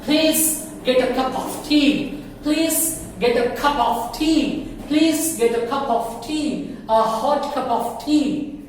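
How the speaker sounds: very slow, every word laid out; slow model sentence. Shown as a demonstration. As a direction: say "Please get a cup of tea" slowly. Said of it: "slow model sentence".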